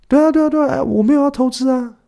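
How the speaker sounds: squeaky-high, overeager protest of innocence; very high, hurried fake innocence; octave-high guilty denial. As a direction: deny it way up high, like someone caught sneaking cake. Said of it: "这种高八度通常都是会偷吃啦".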